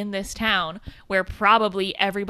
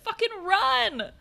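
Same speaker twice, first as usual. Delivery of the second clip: high, slow, strained urgent voice; high-pitched mock panic; panicked quote; 13 semitones higher, slower than usual. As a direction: gasp it out as a frightened warning. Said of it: "I was like".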